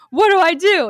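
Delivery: high, loud helpless plea; mock panic. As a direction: reenact panicking and begging mom for help. Helpless after water breaks.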